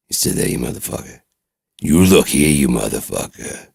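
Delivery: loud bark falling to a growled curse; menacing threat. Acted. Caller returns to threatening the doctor, menacing. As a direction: snap back into forceful menace. Resumes threat after polite call.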